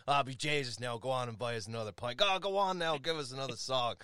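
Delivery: lively put-on Dublin brogue; Dublin accent. Pub-goer calling for another round, Dublin accent. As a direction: egg them on in a hearty Dublin accent. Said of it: "you start talking like you're from Dublin".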